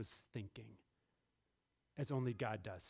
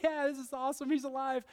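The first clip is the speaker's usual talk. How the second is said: excited disciples; 7 semitones higher than usual. Acted. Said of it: "They're excited".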